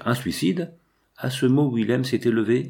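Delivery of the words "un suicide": high, shocked question; alarmed exclamation. A narrator's reading. Abbot reacts to the officer's hinted suicide, alarmed exclamation. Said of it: "m'écriai-je".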